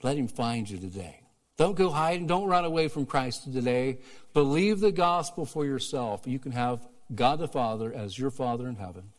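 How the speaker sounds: ordinary talk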